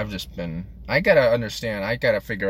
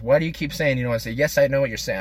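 higher-pitched exasperated retort; annoyed; 5 semitones higher, 3 dB louder, much faster than usual. Retold or imitated. Larry snapping at J B's repeated question, annoyed. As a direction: imitate someone fed up with a verbal tic. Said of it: "He was just, like, annoyed".

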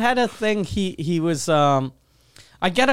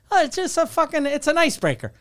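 imitating upbeat dad; 8 semitones higher, faster than usual. Dad voiced in a story.

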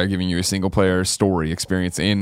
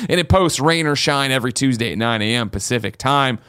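announcer voice; 5 semitones higher than usual. Acted. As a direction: present the show like a host. Podcast host intro announcement.

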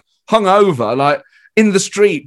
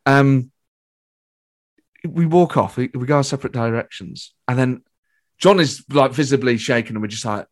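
normal talking voice

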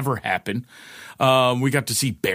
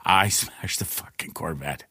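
retold grave insistence; 5 semitones lower, 5 dB quieter than usual.

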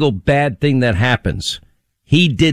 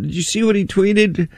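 mock shocked; 9 semitones higher than usual. Mocking media outrage.

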